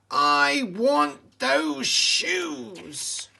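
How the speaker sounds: high, loud, very slow witchy hiss; hissed witch line. A narrator's reading. Wicked Witch hissing her want for the shoes, high, loud and slow. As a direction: drag it out like a greedy witch. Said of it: "she hissed".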